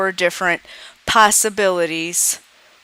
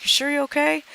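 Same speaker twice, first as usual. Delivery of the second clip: much higher, concerned teacher question; 9 semitones higher, faster than usual. Acted. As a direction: ask a student who looks upset. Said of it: "Teacher's like".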